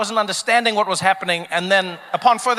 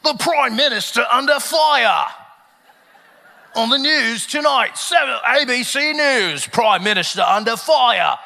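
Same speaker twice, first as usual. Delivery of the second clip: slow, booming mock-grave headline read; TV news announcer; 2 semitones higher, slower than usual. Comedian mimicking Australian news on a major scandal, slow and portentous. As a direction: stretch each headline out with dramatic weight. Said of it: "I saw on the news ... they were like".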